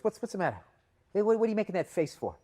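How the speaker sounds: high, prickly heckler-baiting; touchy challenge. Retold or imitated. Offended comic confronting a woman in the crowd, touchy. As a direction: demand an explanation, pitched well up.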